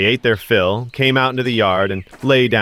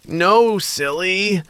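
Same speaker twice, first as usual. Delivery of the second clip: put-on teasing snake girl; 8 semitones higher, much slower than usual.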